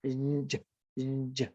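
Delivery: clipped z buzzes; short buzzing z. Shown as a demonstration. Teacher drilling the z sound in short bursts. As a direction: cut each z short. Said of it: "The same thing for that z".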